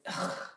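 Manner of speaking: short breathy scoff of disgust; disgusted ugh. Retold or imitated. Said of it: "just disgusted with him".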